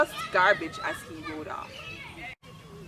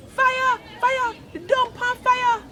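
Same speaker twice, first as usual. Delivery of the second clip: alarm shout; 10 dB louder, slower than usual. Acted. Someone raising the alarm outside.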